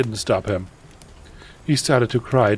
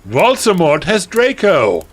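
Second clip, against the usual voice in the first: high, loud alarm cry; panicked shout; 9 semitones higher, 8 dB louder than usual. A narrator's reading. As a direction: shout the urgent news melodramatically. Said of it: "we shouted".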